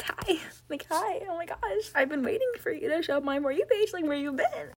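high, bright, excited hello; excited high greeting. Retold or imitated. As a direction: gush like a fan spotting her.

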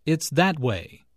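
emphasize "that" above "it's" and "way"; stress on THAT. Shown as a demonstration. Teacher showing a pointed direction, stress on "that". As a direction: punch "that" to point the way. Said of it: "Notice the stress on that".

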